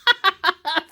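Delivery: bright high burst of laughter; sudden high giggle. Real, not acted. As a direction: laugh out at the dog barking. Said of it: "Patches just barked".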